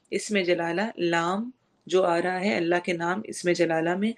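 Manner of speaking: usual speaking voice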